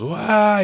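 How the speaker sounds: high, loud acted wail; retold scream. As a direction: imitate a sudden scream, "wah". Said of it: "He starts to yell, screams".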